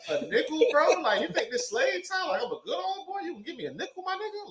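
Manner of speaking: high, indignant rant that keeps climbing; mock outrage. Retelling his outburst to friends, high mock outrage. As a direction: play up the insult of being tipped a nickel.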